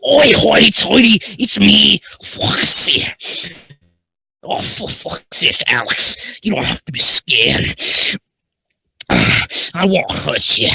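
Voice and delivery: loud, rough-and-ready pirate voice, starting high; pirate accent. Acted. Foxy the pirate fox reassuring Alex, in a loud pirate accent. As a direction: belt it out as a pirate. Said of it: "it was a good pirate accent".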